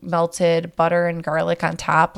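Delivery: ordinary delivery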